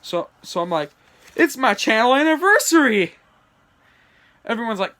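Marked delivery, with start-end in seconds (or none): 1.4-3.2 loud, high proclamation; thrilled shout of big news; excited announcement. Acted. Announcing anniversary in story.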